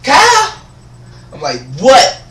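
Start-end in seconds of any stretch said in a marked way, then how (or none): 0.0-0.5 loud, very high squawked call; put-on administrator voice. Administrator calling student out of lunch line, mock shriek. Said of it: "almost lost character right there". 1.8-2.2 loud, high, sharp what; startled retort. Retold or imitated. Student answering administrator's call, loud mock retort. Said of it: "I didn't even say what".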